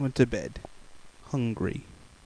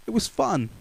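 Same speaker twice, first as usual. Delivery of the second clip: higher, bright; delighted; 9 semitones higher than usual. A narrator's reading. Narrator telling children about the fun of tiptoeing. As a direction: say it with joy.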